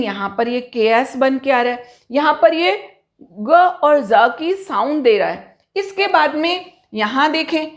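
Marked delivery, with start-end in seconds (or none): none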